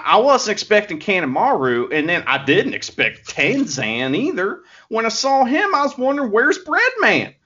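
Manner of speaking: put-on Southern accent, lightly done; a hint of a put-on American South accent; Master Wato Southern accent. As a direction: play a folksy Southern good-guy wrestler. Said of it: "he's putting on a voice".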